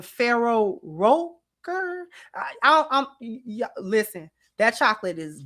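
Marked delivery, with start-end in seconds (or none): 0.0-2.1 slow, drawn-out "Ferrero Rocher?"; careful pronunciation. Shown as a demonstration. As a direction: sound out "Ferrero Rocher?" slowly, unsure. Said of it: "you can't pronounce it either".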